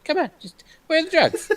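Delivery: mock hero voice gone high; high squeaky Batman imitation. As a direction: squeak out the question. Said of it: "Batman trying to find his voice".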